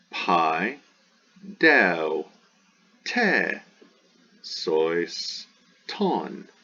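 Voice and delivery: "Pi, deo, te, sois, ton" with a pause after each; syllable by syllable. Shown as a demonstration. Teacher divides a long Greek word, spaced syllables. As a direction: separate every syllable into its own beat.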